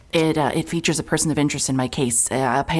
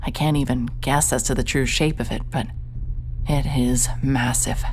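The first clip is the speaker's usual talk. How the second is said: story narration; 4 semitones lower than usual. A narrator's reading. Detective narrating a creepy lab to listeners, hushed narration. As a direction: set an eerie scene, unhurried. Narrator describes dark laboratory.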